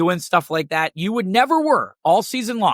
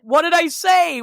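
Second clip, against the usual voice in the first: mock innocent protest; 8 semitones higher than usual. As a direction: play dumb, pushing back with a raised voice. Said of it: "she's like".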